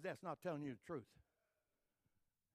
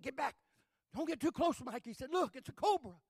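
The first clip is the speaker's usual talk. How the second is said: alarmed warning; 8 semitones higher, 8 dB louder than usual. Retold or imitated. Brother warning Mike.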